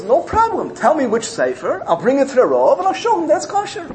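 put-on high-pitched, keen girlish reply; eager girl. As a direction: jump at the chance, voice lifted. Eager girl answers the Taz.

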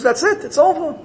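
high-pitched pronouncement of doom; prosecutor. Retold or imitated. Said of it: "The prosecutor says".

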